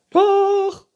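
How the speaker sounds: very high, loud retort; princess calling out. A narrator's reading. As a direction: shriek back stubbornly as princess. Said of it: "rief die Prinzessin". (trotziger, hoher Prinzessinnenschrei)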